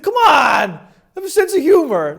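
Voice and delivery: loud, high-pitched protest at an unlaughing crowd; pleading party guy. Acted. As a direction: play the jokester begging for a laugh. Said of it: "That's this guy".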